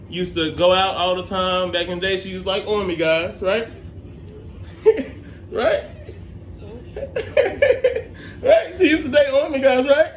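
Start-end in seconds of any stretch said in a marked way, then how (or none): 3.4-10.1 laughing. Real, not acted. Laughing while teasing grandma.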